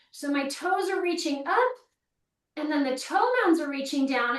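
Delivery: usual speaking voice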